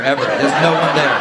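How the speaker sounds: ordinary delivery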